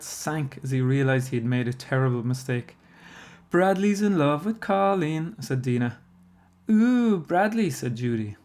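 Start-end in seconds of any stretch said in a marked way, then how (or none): 3.5-5.4 high, loud, slow teasing; singsong taunt. A narrator's reading. Dina to the party about Bradley, taunting. Classmates going crazy over love note. 6.7-7.7 high, loud, drawn-out swoon; teasing ooh. A narrator's reading. Judy to Bradley after the love note, teasing.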